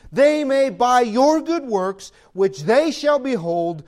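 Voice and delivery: loud, driving pulpit delivery; fiery preacher reading the epistle; preaching at full force. Acted. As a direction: thunder the scripture over the congregation.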